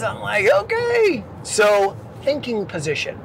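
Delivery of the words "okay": very high little okay; mock meek reply. Trainee answering the yelling instructor, high mock-meek. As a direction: squeak out a mock compliant reply.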